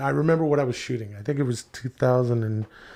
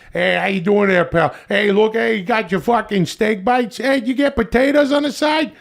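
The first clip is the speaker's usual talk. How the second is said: put-on higher, brash Boston accent; hammed-up Boston guy at a game, a bit higher; 6 semitones higher than usual. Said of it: "these Boston accent".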